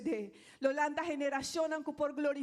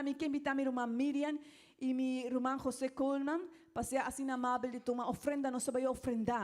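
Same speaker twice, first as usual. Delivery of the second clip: ordinary talk, nothing marked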